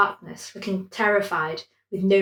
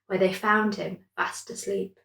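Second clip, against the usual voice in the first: usual speaking voice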